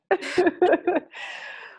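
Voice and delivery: high, loud tearful laugh; laughing through near tears; emotional laugh. Real, not acted. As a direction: burst into a moved, teary laugh. Emotional laugh after thanks.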